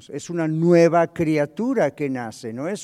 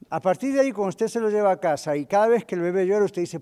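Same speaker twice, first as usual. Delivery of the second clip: normal talking voice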